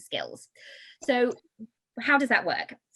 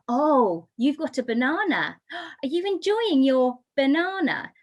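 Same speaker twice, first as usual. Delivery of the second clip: put-on animated, higher baby-directed voice with a gasp; parent talking to baby; 5 semitones higher, 4 dB louder than usual. Shown as a demonstration. Parent repeating banana to a baby, animated and emphatic. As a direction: make a delighted fuss over the key word. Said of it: "The more we repeat it".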